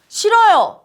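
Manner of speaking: loud, high, defiant refusal; open defiance. Acted. Subordinate talking back to the boss, loud defiance. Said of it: "그거보다 한술 더 떠서".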